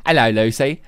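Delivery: hammed-up Aussie hello; a loud mock Australian greeting; mock accent. Host teasing caller Lucy in a mock Australian accent.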